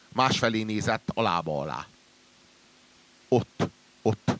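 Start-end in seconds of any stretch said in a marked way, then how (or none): 3.3-4.3 stammering. Acted.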